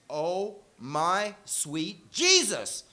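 put-on gasped Houston-accent exclamation at a bleeding kid. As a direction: stretch it out like a Texas mom from Houston. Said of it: "this wonderful accent".

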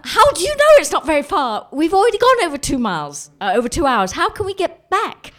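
loud, high, frantic protest; hammed-up hysterical argument; yelling tantrum. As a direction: fire back in a mock meltdown. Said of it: "yelling and crying and just throwing".

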